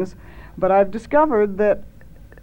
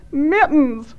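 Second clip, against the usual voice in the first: high, wailed name; tearful cry; 7 semitones higher, 3 dB louder than usual. A narrator's reading. Thad names who is down the well, tearful cry. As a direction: wail the name as if in tears. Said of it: "wept Thad".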